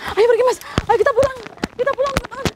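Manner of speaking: high, hurried call to leave; anxious urging. Acted. Ghost hunter urges his friend and viewers to go home, high and hurried. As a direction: get everyone out of here.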